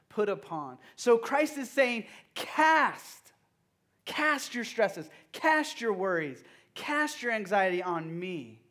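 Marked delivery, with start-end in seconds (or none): 2.3-8.6 raised, slow and emphatic call to cast; Christ's call. Acted. Pastor voicing Christ to the youth group, slow and emphatic. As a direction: plead it slowly, stressing each cast.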